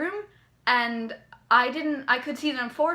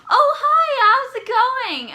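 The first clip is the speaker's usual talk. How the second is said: much higher, loud, slow squealed hello; excited greeting; 12 semitones higher, 11 dB louder, slower than usual. Retold or imitated. Jack greeting the fan, high loud slow squeal. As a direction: squeal it like an overexcited star.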